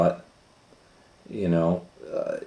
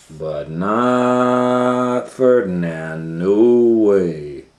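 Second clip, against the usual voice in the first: NOT drawn out very slowly and louder; a firm, slow, loud not; emphatic refusal; 3 semitones higher, 7 dB louder, much slower than usual. A narrator's reading. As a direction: stretch out not Ferdinand, then a loud no way. Narrator stresses Ferdinand's refusal.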